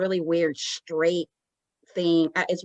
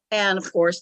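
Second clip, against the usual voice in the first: ordinary talk, nothing marked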